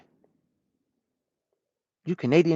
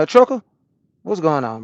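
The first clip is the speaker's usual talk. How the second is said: ordinary talk, nothing marked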